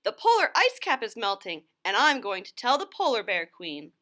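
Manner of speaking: an eager little loon with news; a hint of a small bird; Loony Little's voice. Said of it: "replied Loony Little".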